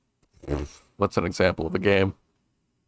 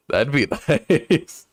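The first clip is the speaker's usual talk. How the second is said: laughing; 4 semitones higher, much slower than usual. Real, not acted. Laughing mid-sentence.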